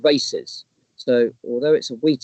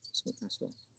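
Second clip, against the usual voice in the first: whispered aside; 14 dB quieter than usual. Real, not acted. Trailing whispered correction.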